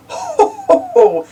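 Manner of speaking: jolly ho-ho laugh; Daddy Pig chuckling at the fixed toy, jolly. A narrator's reading. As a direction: chuckle it out pleased. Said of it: "chuckles Daddy Pig".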